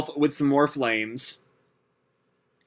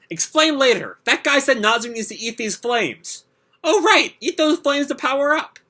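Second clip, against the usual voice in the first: much higher squeaky little cat voice; high cartoon cat voice; 10 semitones higher than usual. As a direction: voice Happy the cat high and eager.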